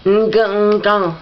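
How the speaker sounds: strained stammering sounds; a mangled attempt at speech; struggling to speak. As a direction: choke on the words, high and strained. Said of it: "I swallow over the words".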